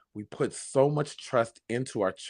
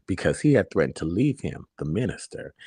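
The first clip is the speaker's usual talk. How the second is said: ordinary delivery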